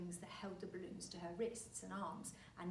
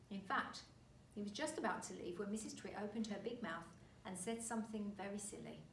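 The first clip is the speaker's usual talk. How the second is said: ordinary delivery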